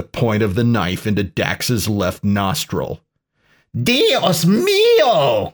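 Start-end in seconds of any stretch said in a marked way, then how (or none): 3.8-5.5 high, drawn-out cry in Spanish. A narrator's reading. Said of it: "cried Chico Juarez".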